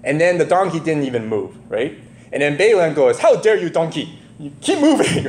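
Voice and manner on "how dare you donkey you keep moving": high, hammed-up scolding; mock-angry outburst, tipping into laughter; mock indignation. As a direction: play an outraged master scolding his animal. Acting out angry Balaam scolding donkey.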